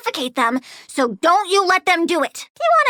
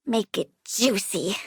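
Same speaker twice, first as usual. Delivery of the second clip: low purr; 8 semitones lower, 7 dB quieter than usual. Acted. Low softer command.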